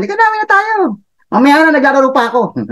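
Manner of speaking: put-on high, fast whiny child voice; be the kid who won't stop playing; kid begging to keep playing.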